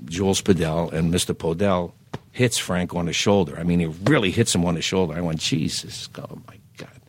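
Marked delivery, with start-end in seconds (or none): 5.4-7.0 a stunned retold whisper; shocked whisper. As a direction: gasp it out under your breath. Shocked by Podell hitting Frank.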